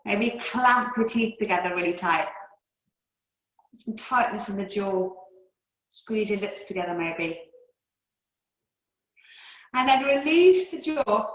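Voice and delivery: normal talking voice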